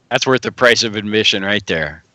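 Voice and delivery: normal talking voice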